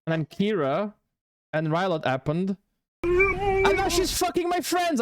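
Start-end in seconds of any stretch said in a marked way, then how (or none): 3.0-5.0 high-pitched, indignant cry; sudden high wail of mock fury; mock outraged outburst. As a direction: burst out in playful jealous outrage. Mock rant about being mad.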